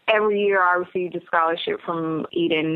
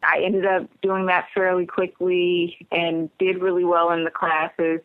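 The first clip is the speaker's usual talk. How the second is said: ordinary delivery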